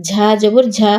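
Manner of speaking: no stretch on ঝা; short vowel. Shown as a demonstration. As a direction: end on a short ঝা. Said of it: "কোথায় দ্রুত পড়ি".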